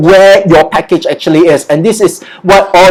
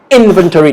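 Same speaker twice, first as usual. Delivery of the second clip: "Inventory" with weight on "In"; stress on first syllable; faster than usual. Shown as a demonstration. Teacher repeats for learners, "Inventory" stressed on "In". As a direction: start strong on "In", falling away. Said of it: "the first syllable".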